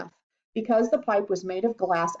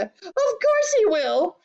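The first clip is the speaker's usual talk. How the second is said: high, drawn-out retort; slow, high indignant cry; shouted; 12 semitones higher, 4 dB louder, slower than usual. A narrator's reading. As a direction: shout back stubbornly, stretching it. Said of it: "shouted Missus Globe".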